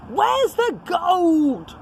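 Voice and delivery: high, slow, angry demand; angry pirate shout. Acted. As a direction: stretch the words out in fury. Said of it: "shouted Hank Chief".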